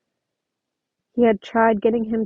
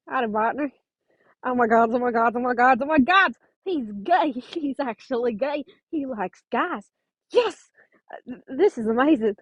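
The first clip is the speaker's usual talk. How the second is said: put-on Will's accent; 2 semitones higher than usual. Will reacting to Nico coming out, put-on accent. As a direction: gush it out in Will's excited accent. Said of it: "that Will accent".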